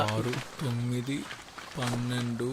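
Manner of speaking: very soft, slow tally; counting to himself. Acted. Checking the sum before his friend, counting under his breath. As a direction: murmur each number as you count.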